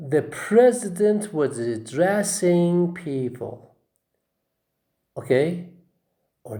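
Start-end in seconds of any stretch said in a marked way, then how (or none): 0.1-3.7 "addressing" stressed on DRESS; slow and raised, stretching DRESS in "addressing"; second-syllable stress. Shown as a demonstration. Teacher models the verb in a sentence, stressing DRESS in "addressing". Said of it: "But you say".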